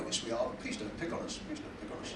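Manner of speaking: timid whisper on behalf of the audience; hushed, meek mock whisper; very quiet. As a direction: shrink to a whispered plea, hoping not to be noticed. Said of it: "They're very quiet".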